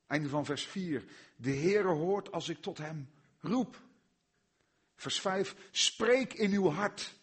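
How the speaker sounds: loud, rising, fervent pulpit voice; preaching at full force. Acted. Preacher urging his congregation to cry out to God, loud and fervent.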